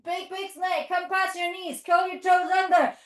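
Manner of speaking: normal talking voice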